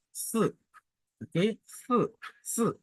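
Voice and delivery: Ce falling hard from high; fourth tone. Shown as a demonstration. Teacher drilling the falling tone on Ce. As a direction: drop Ce sharply. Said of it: "le ton descendant".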